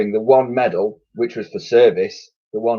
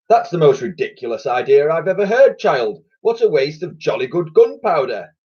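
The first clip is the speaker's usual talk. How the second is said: scornful admiral; 7 semitones higher, 4 dB louder than usual. A narrator's reading. Admiral scolds the child.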